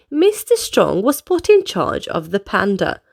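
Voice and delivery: plain reading voice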